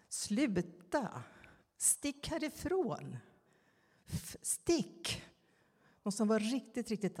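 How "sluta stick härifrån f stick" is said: mock child's yell, higher and drawn out; imitating angry child. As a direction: act out a furious little kid shouting outside, without full volume. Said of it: "en ilsken barnröst utanför som skriker". (härmar en ilsken barnröst som skriker)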